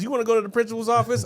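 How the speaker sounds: high and fast scolding question; teacher threatens to send kid out; snippy teacher. Retold or imitated. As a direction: rattle it off as an annoyed teacher. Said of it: "she goes".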